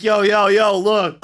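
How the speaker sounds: shouted hype opener; loud hype call. Retold or imitated. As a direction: yell it like a hype man. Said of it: "stupid like".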